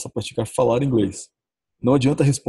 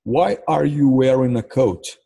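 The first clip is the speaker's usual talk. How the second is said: slow model sentence; 2 semitones higher, 4 dB louder, much slower than usual. Shown as a demonstration. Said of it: "É assim, ó".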